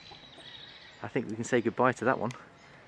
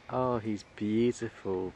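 ordinary delivery

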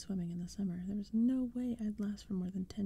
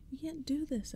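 much higher, small squeaky scream; tiny mouse voice; 7 semitones higher than usual. A narrator's reading. Boy protesting to intruders in a tiny high mouse voice. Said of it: "high and tiny like a mouse voice".

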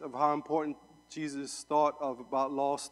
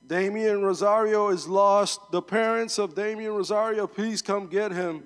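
PA announcement; 4 semitones higher, 6 dB louder than usual. Retold or imitated. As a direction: call it out like the park PA. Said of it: "they, uh, announce your name over the P A".

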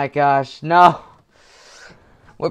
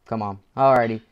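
usual speaking voice

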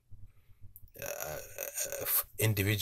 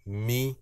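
"Mi" pitched clearly high; 5 semitones higher than usual. Shown as a demonstration. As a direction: lift "Mi" well above normal speech. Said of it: "high intonation".

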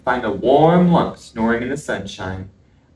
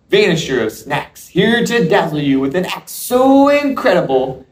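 put-on kids party magician. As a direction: ham up a circus ringmaster pitch. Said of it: "Bear's ready to put on a show".

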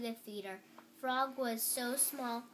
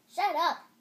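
loud, high outburst; screamed; 6 semitones higher, 12 dB louder than usual. A narrator's reading. Toad silencing the announcer voice, loud scream. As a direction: cut in with a sharp yell. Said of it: "screamed Toad".

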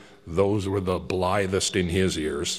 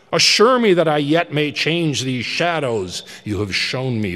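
louder, higher frantic begging; desperate pleading Scrooge; 5 semitones higher, 6 dB louder than usual. Acted. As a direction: cry out for mercy over your own grave. Scrooge begs at his own grave.

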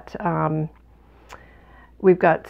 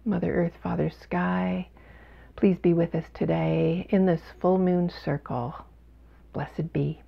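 quiet prayer, trailing softer at the end; soft invocation; 5 dB quieter than usual. Real, not acted. Host calls Mother Earth and Father Sky to join, quiet prayer. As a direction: say a hushed blessing.

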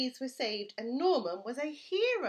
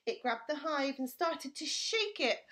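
usual speaking voice

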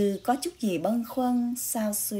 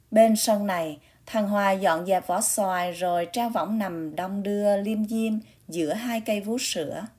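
plain reading voice

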